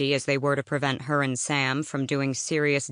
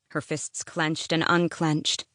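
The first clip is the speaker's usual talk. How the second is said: ordinary delivery, nothing marked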